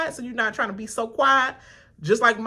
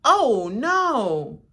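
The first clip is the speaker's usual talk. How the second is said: mock fake dismay; 4 semitones higher, 3 dB louder than usual. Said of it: "you was all like".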